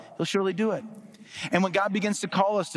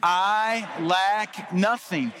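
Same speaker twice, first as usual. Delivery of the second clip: very slow, emphatic declaration; like you mean it; 3 semitones higher, much slower than usual. Shown as a demonstration. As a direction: stretch every word with full conviction. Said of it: "this time like you mean it".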